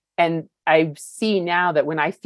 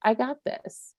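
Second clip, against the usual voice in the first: confident self-talk; 9 semitones higher, 6 dB quieter than usual. Retold or imitated. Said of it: "that one's a much easier to say".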